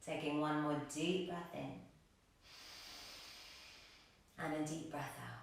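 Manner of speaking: soft, soothing meditation voice with long, restful pauses; guided meditation. Real, not acted. Yoga teacher inviting gratitude into the practice, soft guided meditation. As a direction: settle them into stillness, quietly and without hurry.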